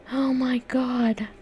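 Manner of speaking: slow, high lament; real dismay. Real, not acted. Artist stares at her scratched new tablet, slow dismay. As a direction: draw it out slowly in high-pitched dismay.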